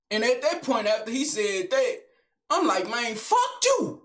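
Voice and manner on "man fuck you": retold defiance. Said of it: "I straight said".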